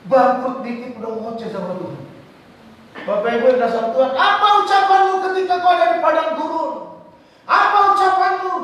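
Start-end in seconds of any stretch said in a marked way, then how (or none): 4.1-8.6 preaching call. Acted. Preacher challenging congregation.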